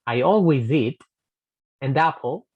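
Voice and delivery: "always eat an apple" run together; linked. Shown as a demonstration. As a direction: link each consonant to the next vowel. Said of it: "lo hago como si fuera".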